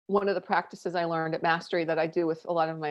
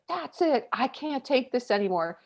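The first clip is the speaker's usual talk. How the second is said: imitating husband, higher and exasperated; fed-up outburst; 6 semitones higher than usual. Husband hearing the whiteboard fall, exasperated. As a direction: mimic the husband snapping at the noise. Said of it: "he was like".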